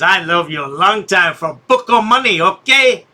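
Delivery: lightly put-on broken English. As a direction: tease in a mock accent. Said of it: "He thinks that's racist".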